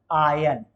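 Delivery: आयन, r silent, like "aa-yan"; iron said right. Shown as a demonstration. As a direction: say आयन with no r sound. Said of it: "उच्चारण होता है इसका". (आयरन नहीं, आयन बोलिए)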